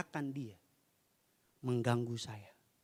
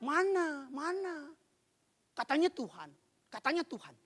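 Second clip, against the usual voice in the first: mock complaining; 14 semitones higher than usual. Shown as a demonstration. Complainer challenging God to show himself, mock whining complaint. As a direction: demonstrate a doubting grumble, pitched up. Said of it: "Kalau keluhan".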